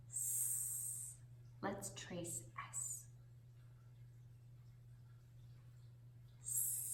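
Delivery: "S" hissed, soft and long; snake hiss. Shown as a demonstration. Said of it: "like a snake".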